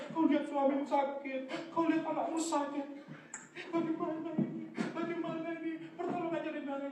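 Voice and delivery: high, hushed fretting; mock-anxious wail in a small high voice; worried lament. Acts out worried believer.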